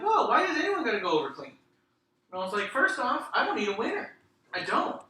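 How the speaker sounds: high, loud mock objection; retold indignant protest. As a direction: argue back with pitch jumping up. Retelling argument with brother.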